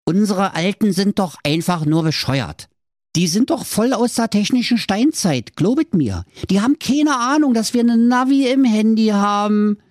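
put-on high-pitched boy ranting about parents; scornful teen. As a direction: build up in pitch, scoffing as Hänsel. Hänsel rants about clueless parents.

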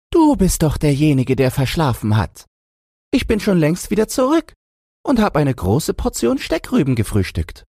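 high squeaky piglet voice; high-pitched pig, pleased to have won; taunting little pig. A narrator's reading. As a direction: crow at the wolf in a tiny pig squeak.

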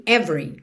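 "every" said "evry", middle E dropped; two-syllable every. Shown as a demonstration. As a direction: join the V straight into the R in "every". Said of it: "o E do meio não é pronunciado". ("every" sem o E do meio, "évri")

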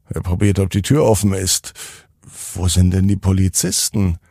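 usual speaking voice

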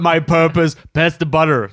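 a tinny little robot asking its purpose; rusty robot. Retold or imitated. Butter robot quoted from show.

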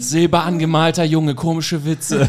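mock story narration. Continues parody narration laughing.

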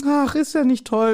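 wife brushing it off in hammed-up falsetto. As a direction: pip it out like a silly wife. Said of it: "die Frau kommt dazu".

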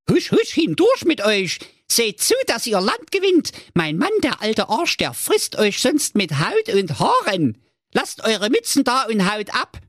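high sweet ogress voice; dutiful cartoon wife. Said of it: "zeigte sie ihnen die Katzenklappe und sprach". (hohe Stimme der Menschenfresserin)

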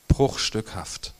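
ordinary talk, nothing marked

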